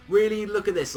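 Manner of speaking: mock her with a sneering lift; scornful Vados. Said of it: "Violet's like".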